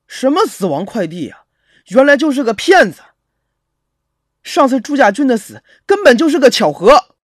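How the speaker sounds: rising to loud, high indignation; indignant, building to a shout; angry outburst. Acted. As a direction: rant like someone who has been cheated. Realizes the delivery was fake.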